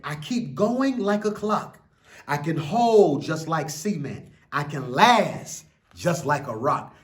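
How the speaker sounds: put-on slow, lilting read-aloud for children; an expressive children's book reading; story reading. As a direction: read it like a bedtime picture book. Reads picture book aloud.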